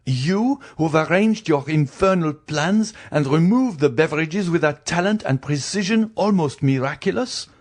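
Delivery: high, emphatic cross-examination; accusing husband. Acted. Villefort accuses his wife.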